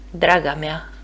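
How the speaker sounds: ordinary delivery, nothing marked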